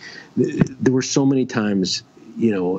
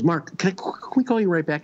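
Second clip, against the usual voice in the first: a lightly voiced Artie, higher and a bit fast; imitating Artie; 8 semitones higher, faster than usual. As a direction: mimic Artie hurrying his friend off the phone. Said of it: "he goes".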